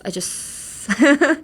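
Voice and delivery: "just" tossed off like a jock's thanks; sporty "just". Shown as a demonstration. Teacher shows "just" like a sports club greeting. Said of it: "あざっすみたいな感じの".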